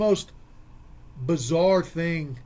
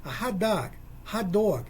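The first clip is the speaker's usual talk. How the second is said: New Jersey hot dog; 2 semitones higher than usual. Retold or imitated. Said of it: "that's how he said it for me to understand him".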